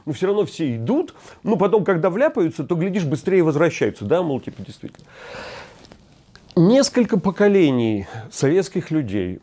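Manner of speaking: ordinary talk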